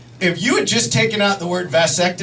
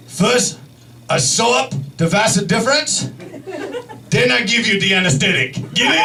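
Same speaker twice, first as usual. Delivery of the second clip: put-on Japanese-accented doctor telling his joke; mock Japanese accent, pausing between phrases; imitated Japanese accent. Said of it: "this is his voice".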